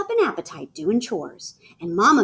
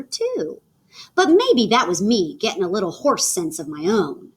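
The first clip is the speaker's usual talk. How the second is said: plain reading voice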